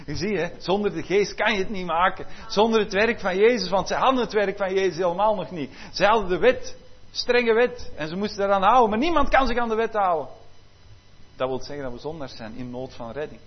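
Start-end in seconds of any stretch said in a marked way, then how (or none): 0.1-10.3 loud preaching with a higher pitch; preacher pressing the point hard; full-force preaching. Acted. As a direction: drive the point home from the pulpit.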